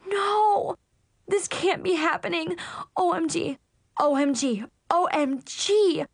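high-pitched, mounting wail of distress; panicked dismay. A narrator's reading. Girl realizing her crush might move away, panicked wail. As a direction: melodramatic teen panic.

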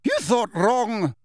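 slow, high, angry snub; furious, emphatic dismissal; seething rebuff. A narrator's reading. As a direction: shut the intruder down. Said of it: "to see sparks fly".

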